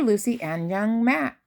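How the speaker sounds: ordinary delivery, nothing marked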